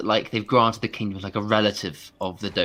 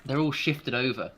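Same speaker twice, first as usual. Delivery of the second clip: ordinary talk, nothing marked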